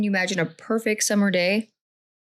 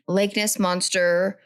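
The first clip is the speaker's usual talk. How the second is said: ordinary talk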